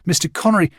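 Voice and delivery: put-on boy calling after Connery on the beach, first call. A narrator's reading. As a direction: call out to him across the sand. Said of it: "I called to him".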